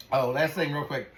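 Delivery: normal talking voice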